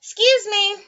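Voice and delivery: animated character apology; otter saying excuse me. A narrator's reading. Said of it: "It says".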